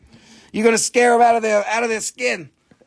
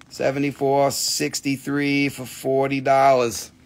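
usual speaking voice

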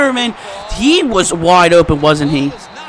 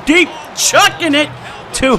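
excited shout; 6 semitones higher than usual. Real, not acted. Excited throw call.

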